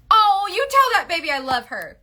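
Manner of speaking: high, loud burst of affection; affectionate gushing. Real, not acted. Host sending love to a viewer's baby, high gushing. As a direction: break off and gush it high. Interrupts herself to gush over child.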